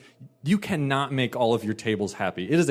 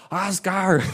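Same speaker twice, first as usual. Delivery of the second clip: mock yell; 6 semitones higher than usual. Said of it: "starts yelling across the entire restaurant".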